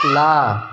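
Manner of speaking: ला with the tongue on the gum, said loud; lateral demo. Shown as a demonstration. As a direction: let the air slip past the sides on ला. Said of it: "कौन कौन से?". (पार्श्विक ध्वनि ला)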